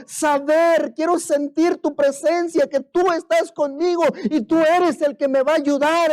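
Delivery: high, loud pleading prayer; earnest model prayer. Pretend. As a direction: cry out to the Lord, stammering. (oración ferviente, aguda y fuerte)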